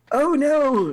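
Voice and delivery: high-pitched put-on elf cry; mimicked elf calling out. Disguised player yells to the devils up the hallway, high mimicked call. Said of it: "yell out in, like, kind of like".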